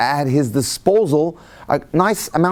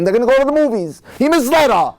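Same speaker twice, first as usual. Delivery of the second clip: mock indignation; 9 semitones higher, faster than usual. Mocking her expectation.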